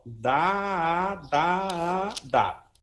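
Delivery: drawn-out "Dá, dá, dá", last one shorter; syllable demo. Shown as a demonstration. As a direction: demonstrate "Dá, dá, dá" as sustained articulation syllables. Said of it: "essa aqui, ó".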